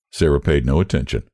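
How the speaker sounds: plain reading voice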